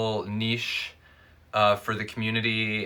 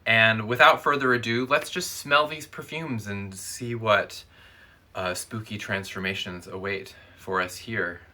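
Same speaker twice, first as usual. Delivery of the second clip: normal talking voice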